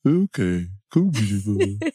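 slow, playful giving in to temptation; hammed-up sheepish surrender; mock surrender. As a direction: cave in to the treat, drawn out. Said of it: "And sometimes I go".

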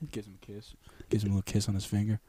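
soft, tender and hushed. Acted. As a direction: murmur it softly, tender. Tender Jesus voice.

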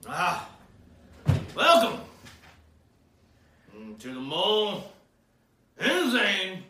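big showman host intro; announcer voice. Acted. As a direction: belt it out like a TV host.

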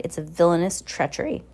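ordinary delivery, nothing marked

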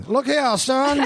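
high, drawn-out Georgia sheriff drawl; thick Georgia sheriff twang; Southern sheriff. Acted. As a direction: holler it like a Southern movie lawman. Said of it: "be like a Southern, like a Georgia sheriff".

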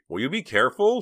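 loud, high exasperated snap; sharp scolding. Acted. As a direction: snap at her to be careful. Nervous friend snaps after a clatter.

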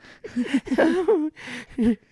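shrill gleeful goblin laugh; high goblin giggle. Acted.

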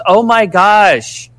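high, very slow, hammed-up "Oh my gosh"; badly faked surprise. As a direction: mimic her bad acting, stretching "Oh my gosh" out. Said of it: "like, really, like, bad acting".

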